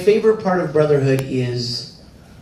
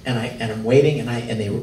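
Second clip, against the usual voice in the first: ordinary talk, nothing marked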